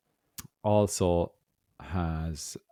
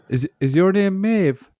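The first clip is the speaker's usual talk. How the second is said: high clerk voice; 12 semitones higher, 4 dB louder, slower than usual. Retold or imitated. Clerk calling name, voiced.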